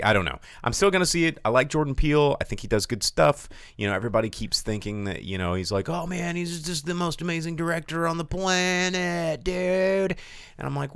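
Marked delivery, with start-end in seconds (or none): 5.9-10.2 high, drawn-out fan gushing; mock an awestruck superfan, pitched well up; mock-reverent dude voice, high and sustained; gushing fanboy. Voicing a gushing fan of Peele.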